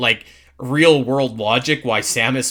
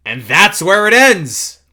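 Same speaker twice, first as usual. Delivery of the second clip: loud, high, drawn-out finale; dramatic announcement; 6 semitones higher, 8 dB louder, slower than usual. Real, not acted. Host announces the end of the demo footage, loud and dramatic. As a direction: proclaim it big and slow, pitched up.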